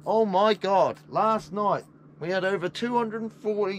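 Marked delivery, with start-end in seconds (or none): none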